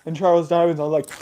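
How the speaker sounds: ordinary delivery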